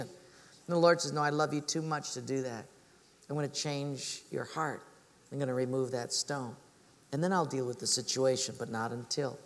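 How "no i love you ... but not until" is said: low, soft and loving; tender reassurance. Retold or imitated. The Lord answers people wanting situations changed, gently reassuring. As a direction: voice a patient God answering gently.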